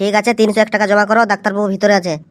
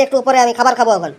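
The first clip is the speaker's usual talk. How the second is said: usual speaking voice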